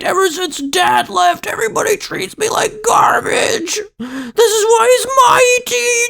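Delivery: put-on high, wailing, tearful shriek of a spoiled boy; crying child tantrum. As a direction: bawl it out like a kid whose toy is being taken. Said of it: "and he goes".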